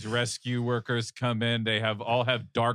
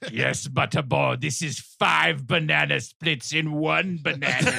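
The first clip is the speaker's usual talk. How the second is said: exasperated demon leader, raised pitch; put-on voice of a fed-up Cenobite; 5 semitones higher, 4 dB louder than usual. Cenobite leader confronts Butterball over banana splits, exasperated.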